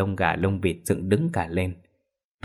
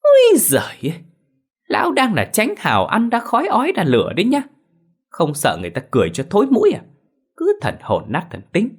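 a much higher, louder scornful outburst; mock his cowardice sharply; 13 semitones higher, 5 dB louder than usual. Wife deriding her cowardly husband, high loud scorn. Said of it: "Mụ Hường nghe thấy vậy thì rít nhẹ".

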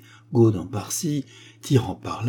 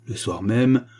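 usual speaking voice

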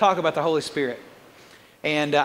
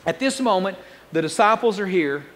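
usual speaking voice

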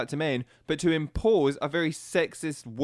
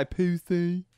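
pussy in a put-on Family Guy character voice; 4 semitones higher than usual. Co-host playing up the Family Guy sound, put-on voice. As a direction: ham up pussy like the cartoon guy. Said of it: "You make me sound like that guy off Family Guy".

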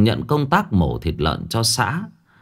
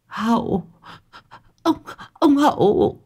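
much higher, strained and desperate; dying cry; 13 semitones higher than usual. A narrator's reading. Old woman wakes and cries the ghost's name, near death. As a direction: cry out like someone about to die. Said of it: "kêu lên như người sắp chết".

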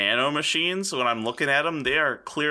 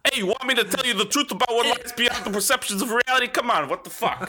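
high, pushed mock Pesci rant; Joe Pesci impression; 10 semitones higher than usual. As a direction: play Pesci as a cosmic horror. Eldritch Pesci voice.

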